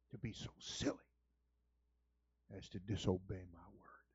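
God finishing the rebuke, softly; whispered rebuke. Pretend. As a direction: drop to a hush for the rebuke. God's rebuke dropping low.

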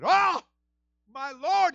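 high loud alarmed cry; Aaron crying out. Acted. Aaron seeing Miriam's leprosy, crying out. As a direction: cry out in shock.

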